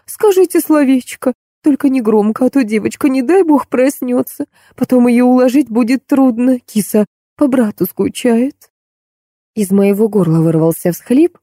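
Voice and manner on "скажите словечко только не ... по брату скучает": high-pitched, fussing nanny voice; anxious nanny. Acted. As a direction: plead anxiously in a raised pitch. Anxious nanny pleads for quiet. (обеспокоенно, высоким голосом)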